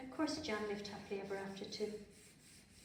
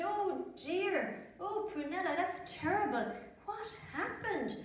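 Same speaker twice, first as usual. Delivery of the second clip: put-on higher boy's voice, a touch of alarm; a friend gently shocked, pitched up; surprised John; 9 semitones higher than usual. A narrator's reading. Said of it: "and he said".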